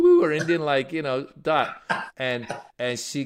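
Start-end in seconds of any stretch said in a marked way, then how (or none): 0.0-1.8 high, loud joking quote. Retold or imitated. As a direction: tease her with a hammed-up voice. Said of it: "I jokingly, I was a little drunk".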